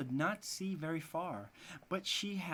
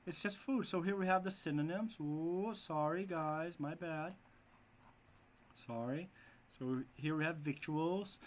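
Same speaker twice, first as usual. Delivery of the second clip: ordinary talk, nothing marked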